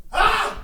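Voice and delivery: short high-pitched yelp imitating mom; a sudden high "Ah!" to startle a dog; imitated scream. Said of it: "Just screaming at dogs".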